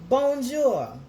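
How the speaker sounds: loud, high bonjour in a French accent; French greeting. Shown as a demonstration. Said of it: "any French folks watching".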